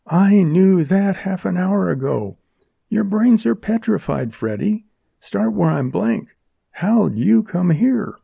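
high, sharp impatient woman. A narrator's reading. Jerry impatient, female voice.

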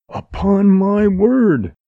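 high, loud, slow protest; astonished exclamation. Acted. Montgomery protests his innocence, astonished. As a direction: draw it out, utterly taken aback.

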